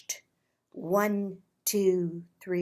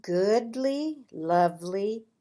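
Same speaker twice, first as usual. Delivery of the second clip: ordinary delivery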